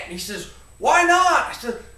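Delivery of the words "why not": loud, high, impatient shout; dad shouting back, exasperated; yelled question. Retold or imitated. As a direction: bark the question across the field. Dad yells across field.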